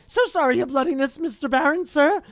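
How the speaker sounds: high, loud oily apology; greasy grovelling poltergeist. Acted. Peeves grovelling before the Bloody Baron, high loud oily voice. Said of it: "he said greasily".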